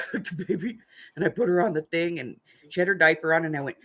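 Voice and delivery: ordinary talk, nothing marked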